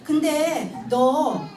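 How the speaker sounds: very slow, teasing drawn-out question; drawn-out tease. Retold or imitated. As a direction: stretch it out playfully to the child. Mother questions daughter teasingly. (아이에게 장난스럽게 아주 느리게)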